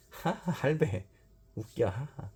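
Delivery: 높게 깔깔거리며 놀리기; 친구를 비웃듯 웃으며; mocking laughter. 아이들이 놀리며 웃음. (깔깔대며 놀리는 아이)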